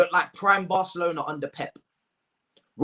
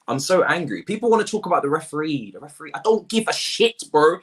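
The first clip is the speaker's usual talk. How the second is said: usual speaking voice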